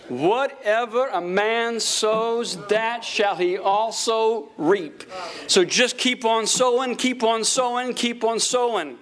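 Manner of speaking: high, drawn-out scripture declaration; preaching. Real, not acted. Preacher urges congregation to keep on sowing, high preaching cadence. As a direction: proclaim it high, stretching out the words.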